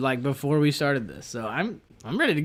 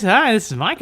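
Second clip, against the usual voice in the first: bright higher phone greeting; customer service voice; 7 semitones higher, 5 dB louder than usual. Shown as a demonstration. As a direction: greet like a call centre agent. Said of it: "My customer service voice".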